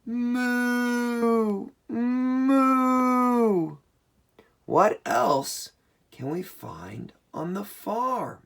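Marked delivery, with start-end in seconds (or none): 0.1-3.8 long, high, held moo; cow moo. Pretend. Showing kids what cows say, high long mooing. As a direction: imitate a cow with drawn-out high moos. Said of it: "And the cows go".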